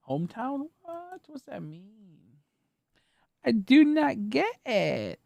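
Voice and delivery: high, slow bewildered questions; high slow mock puzzlement; mock confusion. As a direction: play dumb, high and drawn out.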